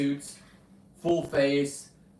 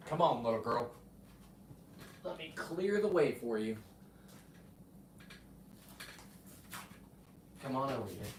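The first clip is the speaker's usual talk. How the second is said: coaxing dog; 2 semitones lower, 10 dB quieter than usual. Real, not acted.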